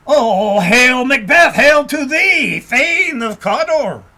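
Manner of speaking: loud, high proclamation, the end drawn out slowly; witch's hail. A narrator's reading. Second witch hailing Macbeth as Thane of Cawdor, loud and slow. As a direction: cry out the greeting across the moor. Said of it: "The second said".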